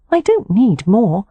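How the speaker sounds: a light hint of a pout; stubborn young wife. Poppy refuses dresses.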